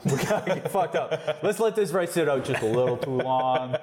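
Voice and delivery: mock Jersey guy, half laughing; New Jersey accent. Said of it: "I'm talking like I'm from New Jersey".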